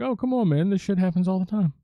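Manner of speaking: high, breezy, unbothered shrug of a line; blasé Sonic. Retold or imitated. Sonic brushing off the panicking guy, high and blasé. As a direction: play it like nothing's weird at all. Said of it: "Sonic is really blasé about it".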